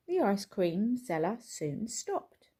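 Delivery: ordinary delivery, nothing marked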